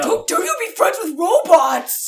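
slow, loud whiny villain; mock villain rant. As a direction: ham up a petty bad guy. Mocking villain's complaint.

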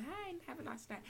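high, bright customer-service greeting; high cashier voice. Retold or imitated. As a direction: chirp it to the customer. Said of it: "going, saying".

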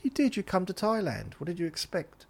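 high-pitched, quick-fire comeback; argumentative retort. Retold or imitated. Acted quarrel with the stallholder.

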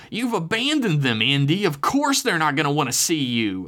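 high, accusing interjection; woman butting in. A narrator's reading. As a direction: chime in sharply with a put-on female voice. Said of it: "a female voice chimed in from just beyond the phone".

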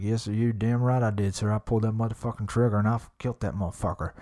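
fast, swaggering cowboy brag; Yellowstone cowboy drawl. Retold or imitated. Bragging to a hunting guide, quick cowboy drawl. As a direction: imitate yourself playing the proud cowboy. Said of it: "My whole voice changed".

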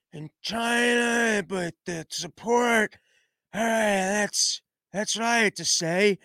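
slow, halting, mumbled ramble; rambling Biden. Retold or imitated. Biden fumbles through his speech ending, slow and halting. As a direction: lose the thread word by word. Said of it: "He goes".

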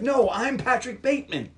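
high-pitched mock exasperation; insistent protest. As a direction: insist on who you are. Acted protest to lawyer.

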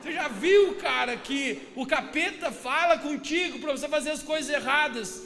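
high, very loud preacher delivery; full-force preaching. Real, not acted. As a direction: drive the warning home to the congregation. Pregação sobre o capeta. (pregação inflamada, em voz alta)

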